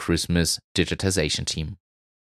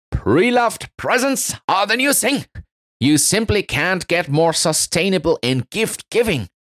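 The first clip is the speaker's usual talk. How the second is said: high excited elf voice; 10 semitones higher than usual. Acted. Elves' excited voices in break room.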